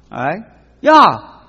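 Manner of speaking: normal talking voice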